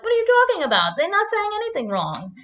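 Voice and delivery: high-pitched mock German husband; German accent. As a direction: protest in an exaggerated German accent. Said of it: "'cause he has a German accent".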